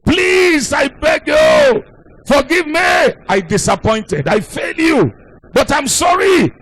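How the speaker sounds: high-pitched, acted desperate begging; crying aloud. Elderly husband begging his wife's forgiveness, acted high tearful plea. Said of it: "crying aloud and said".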